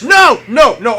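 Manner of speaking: loud, high playful "no"s; mock protest. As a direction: protest the lost title in mock despair. Title revoked, protests.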